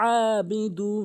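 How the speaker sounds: "আবিদু" with ayn squeezed mid-throat; pressed ayn. Shown as a demonstration. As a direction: press hard on the start of "আবিদু". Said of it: "চাপ দিয়ে".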